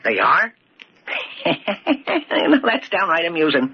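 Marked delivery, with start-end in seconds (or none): 1.1-2.6 high, light chuckle at the news; amused laugh. Acted. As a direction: laugh it off, tickled by the idea. Old man amused at police search.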